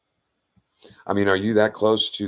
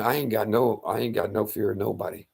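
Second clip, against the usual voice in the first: normal talking voice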